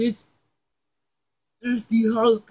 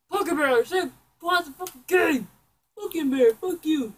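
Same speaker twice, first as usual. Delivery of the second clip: mock angry yell; 6 semitones higher, 3 dB louder than usual. Yelling at Pumpkin Bear.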